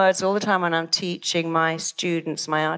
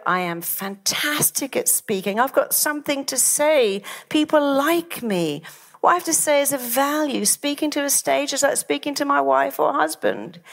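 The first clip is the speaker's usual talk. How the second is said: higher-pitched, upbeat self-affirmation; confident self-talk; 7 semitones higher than usual. Shown as a demonstration. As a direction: talk yourself up before a speech. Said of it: "Or you can go".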